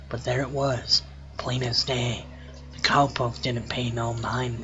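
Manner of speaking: low, hushed campfire storyteller; spooky story narration. Acted. Reading a cowpoke ghost story aloud in a low, hushed voice. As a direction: read it low and soft, building suspense.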